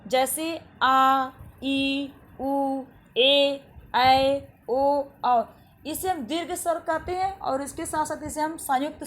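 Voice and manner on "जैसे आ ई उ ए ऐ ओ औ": आ ई उ ए ऐ ओ औ each held long and said loud; long vowel demo. Shown as a demonstration. Teacher shows children the long vowels, drawn out and loud. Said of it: "उन्हें हम कहते हैं दीर्घ स्वर".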